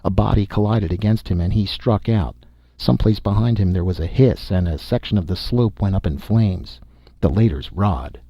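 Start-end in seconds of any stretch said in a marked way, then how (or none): none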